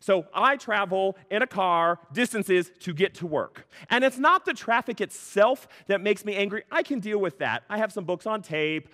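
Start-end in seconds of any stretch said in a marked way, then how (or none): none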